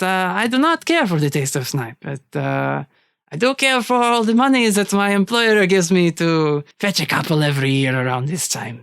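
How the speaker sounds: put-on polite but unbending French-accented villain. Hunter declining to release the snipes, French accent. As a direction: refuse courteously in a French accent. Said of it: "sounded like a genuine French accent".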